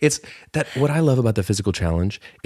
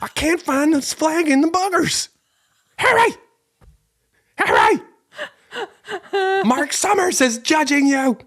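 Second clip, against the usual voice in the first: much higher whiny voice urging Harry on; high-pitched mock mom. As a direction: shriek at Harry like a frantic game-show mom. Cindy voice again.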